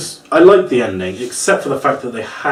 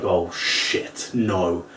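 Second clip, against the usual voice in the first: hushed dismay; 8 semitones lower, 9 dB quieter, much slower than usual. Retold or imitated. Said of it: "and it's like".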